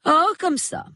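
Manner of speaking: high-pitched, airy brush-off; vague reply. A narrator's reading. Wife dodging her husband's question, vague. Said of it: "répondit-elle d'un air vague".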